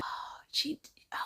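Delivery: hushed, half to yourself; whispering to self. Real, not acted. Vlogger trying to recall a YouTuber's name, whispered. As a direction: whisper it while searching memory.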